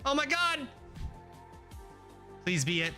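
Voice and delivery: usual speaking voice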